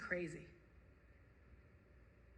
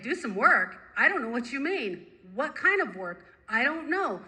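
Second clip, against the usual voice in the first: higher, louder, bewildered protest; animated confusion, pitched up; baffled questioning; 4 semitones higher, 5 dB louder than usual. Retold or imitated. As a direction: question the order, thrown and agitated. Baffled reply to the inner command.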